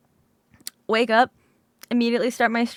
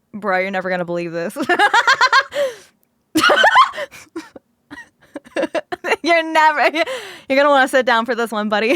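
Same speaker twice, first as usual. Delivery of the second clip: laughing tease; 4 dB louder, faster than usual. Real, not acted. Teasing mock reveal.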